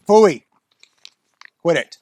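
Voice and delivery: telling the puppy off; scolding the dog. Real, not acted. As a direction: bark the dog's name to stop him.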